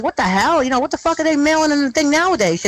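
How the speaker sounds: lightly voiced mailman freaking out; a hint of a rattled Brooklyn postman. Mailman reacting to the ashes, a mild mock outburst. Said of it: "a Brooklyn mailman's going like".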